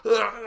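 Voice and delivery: high strained "ugh"; wordless cry with the face blown back; wind-blasted groan. Retold or imitated. Jumper looking uncool in free fall, high strained groan. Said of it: "your face is just like".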